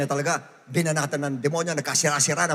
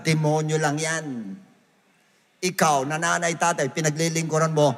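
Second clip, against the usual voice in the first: usual speaking voice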